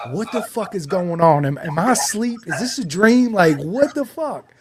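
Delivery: high, loud panicked outburst with a laugh; terrified jumper yelling in the plane; panicked disbelief. Retold or imitated. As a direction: shout it like you can't believe it's real. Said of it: "I'm like".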